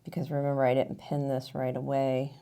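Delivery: usual speaking voice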